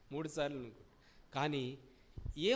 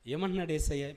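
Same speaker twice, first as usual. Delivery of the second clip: ordinary delivery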